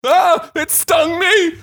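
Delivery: high, slow hammed-up cry of pain; fake sting cry. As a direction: pretend the wasp just got you. Mock wasp sting yelp.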